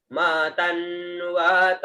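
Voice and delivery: म तन्वत drawn out slowly; slow pronunciation. Shown as a demonstration. Teacher models म तन्वत slowly. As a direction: stretch म तन्वत. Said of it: "उच्चारण हुआ म तन्वत".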